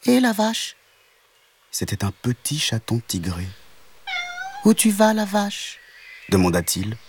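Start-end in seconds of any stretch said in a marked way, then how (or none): 0.1-0.8 very high little call; small kitten voice. Acted. Kitten hails the cow from behind, tiny high voice. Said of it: "une petite voix derrière elle". 4.6-5.8 very high little call; small kitten voice. Acted. Kitten hails the cow from behind, tiny high voice. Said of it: "une petite voix derrière elle".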